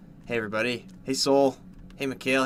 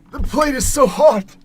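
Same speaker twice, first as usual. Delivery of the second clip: plate too hot; 10 semitones higher, 8 dB louder than usual. Real, not acted. Burning hands on dinner plate.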